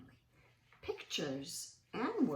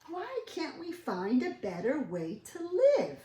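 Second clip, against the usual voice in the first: Stanley asking; 9 semitones higher, 7 dB louder than usual. Acted. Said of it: "asked Stanley".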